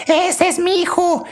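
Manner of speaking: very high-pitched proud grandma shriek; grandma yelling. Retold or imitated. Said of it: "de la abuelita diciendo". (grito muy agudo de abuelita orgullosa)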